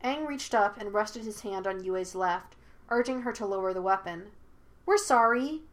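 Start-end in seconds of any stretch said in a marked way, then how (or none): 4.8-5.6 higher, raised apology; calling out. A narrator's reading. Aang apologizes to the hidden spirit, calling out. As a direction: call out an apology. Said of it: "he called out".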